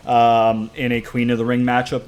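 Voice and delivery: usual speaking voice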